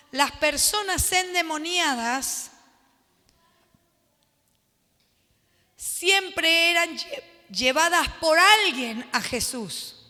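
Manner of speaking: slow, word-by-word dictation pace. Shown as a demonstration. Pastor dictating a note to the class, slowly. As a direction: spell it out slowly so they can write.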